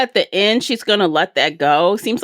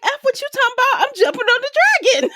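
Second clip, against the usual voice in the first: much higher, fast cartoon dog voice with a laugh; a cheeky pet ignoring orders, giggling; the dog defying its owner, in a high squeaky mock voice; sassy dog; much faster than usual. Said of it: "And the dog's like".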